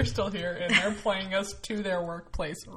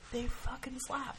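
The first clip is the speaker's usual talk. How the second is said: whisper; 4 semitones higher, 6 dB quieter than usual. Real, not acted. Hushed emphatic praise.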